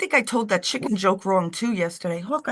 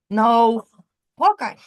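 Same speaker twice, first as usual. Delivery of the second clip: sharp call; 3 semitones higher, 6 dB louder, slower than usual. Real, not acted. Scolding the dog, calling him.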